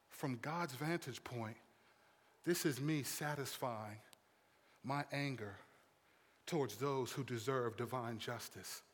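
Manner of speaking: low, soft, measured voice; hushed, weighty calm with pauses; solemn hush. Acted. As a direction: speak for God gravely and quietly. God's view set against man's.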